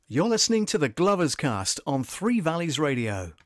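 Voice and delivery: loud, upbeat station ident; radio announcer. Acted. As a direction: punch it like a radio jingle.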